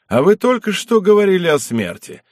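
high, cheerfully teasing exclamation. A narrator's reading. Merry lord chiding his ailing guest, cheerful exclamation. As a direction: exclaim it merrily, starting high. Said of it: "воскликнул весело Зых".

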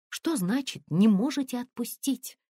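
высокий возмущённый вопрос; переспроси с возмущением, вскинув голос; возмущённо. A narrator's reading. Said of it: "возмутилась Тонечка". (возмущённо, на повышенных тонах)

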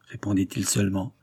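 ordinary delivery

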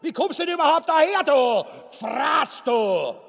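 hoch, laut und schnell keifen; wütend schimpfend. Acted. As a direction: die Frau rasend anfahren. Ubu beschimpft seine Frau. (keifend, viel höher und lauter, schnell)

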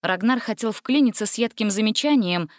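ordinary delivery, nothing marked

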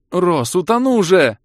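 high, loud squeal; squeaking. Acted. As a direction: squeak out in panic. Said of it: "пропищала".

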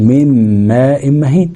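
"من ماء مهين" with noon turned into a held, hummed meem; merged meem. Shown as a demonstration. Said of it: "هندغمها برضو في الميم".

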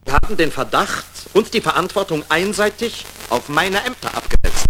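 angry accusing retort; indignant outburst. Acted. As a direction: flare up at the end.

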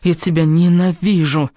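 slow, emphatic hatred; seething, each word stretched; through gritted teeth. A narrator's reading. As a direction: draw out the words with loathing. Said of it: "сквозь стиснутые зубы прошептала она".

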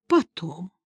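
soft, strained groan; pained moan. Acted. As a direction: moan the word through pain. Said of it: "застонал". (стон от боли)

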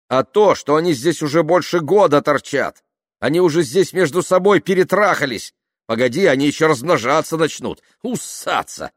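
high, loud, exasperated outburst; heated rant. A narrator's reading. Tamara arguing with kind Nikita about refugees, heated rant. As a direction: argue it hotly at your partner.

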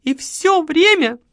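high, loud complaining woman; aggrieved widow. A narrator's reading. Widow complains to the marquis, high and loud. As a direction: pour out her grievances. Margarita continues.

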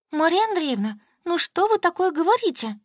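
high, indignant shaming; shaming reproach. A narrator's reading. Солька tries to shame Anya's mother: high indignant reproach. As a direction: reproach her, voice jumping high. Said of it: "попыталась пристыдить".

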